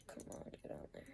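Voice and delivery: barely audible whisper; muttering to himself. Real, not acted. Angler fiddling with a swivel, whispered muttering to the tackle. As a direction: coax it under your breath, very softly. Coaxing tackle onto the line.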